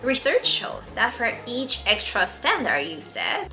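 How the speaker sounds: usual speaking voice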